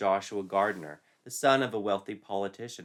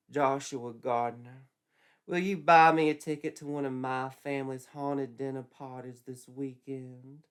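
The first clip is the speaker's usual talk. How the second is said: flirty Betsy; 5 semitones higher, 4 dB louder than usual. Acted. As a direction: draw it out sweetly.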